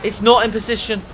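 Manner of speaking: normal talking voice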